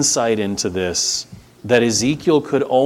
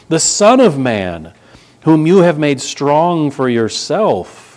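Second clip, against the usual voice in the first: louder, measured reading of the psalm, slowing at the end; scripture reading; 3 semitones higher, 4 dB louder than usual. Acted. Preacher reading Psalm eighty aloud to the church, solemn and raised. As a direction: proclaim the psalm to the congregation.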